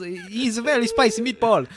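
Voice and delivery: high, loud, laughing mock Italian voice. Drifting off the Jackie attempt into a laughing mock Italian. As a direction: ham it up like a cartoon Italian. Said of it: "Irish last time".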